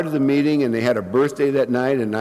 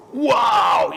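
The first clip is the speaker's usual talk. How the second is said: impressed wow; 8 semitones higher than usual. Retold or imitated. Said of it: "people were going".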